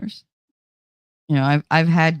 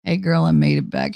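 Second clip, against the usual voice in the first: usual speaking voice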